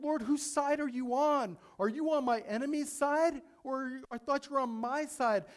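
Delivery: high, loud, aggrieved pleading; hurt protest to God. Retold or imitated. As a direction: plead with God as if he switched sides. Imagined bitter complaint to God.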